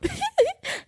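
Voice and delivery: squeaky high burst of laughter. Real, not acted. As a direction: laugh out at the memory. Laughing at retold scene.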